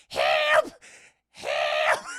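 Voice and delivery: loud desperate shout; screaming for help. Retold or imitated. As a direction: yell for help in terror. Daughter being beaten.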